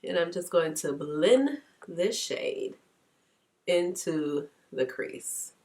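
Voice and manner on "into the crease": usual speaking voice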